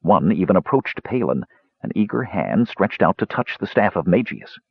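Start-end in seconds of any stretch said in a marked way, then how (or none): none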